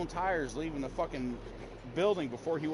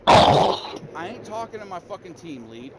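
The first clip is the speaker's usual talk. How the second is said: slow, much louder, furious outburst; angry shout; 13 dB louder, slower than usual. Real, not acted. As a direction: bellow it at the nosy team lead, slowly. Venting about coworker's interference.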